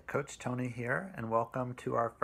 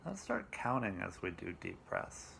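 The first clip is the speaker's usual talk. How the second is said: slow, calm meditation voice with long pauses; guided meditation; 8 dB quieter than usual. Acted. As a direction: guide them gently through it, softer and unhurried.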